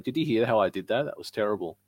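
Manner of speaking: ordinary talk, nothing marked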